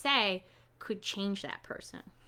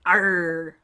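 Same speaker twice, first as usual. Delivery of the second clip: low pirate arr; a hint of a pirate; pirate growl; 5 semitones lower, 5 dB louder than usual. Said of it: "that was a little piratey".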